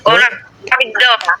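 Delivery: ordinary delivery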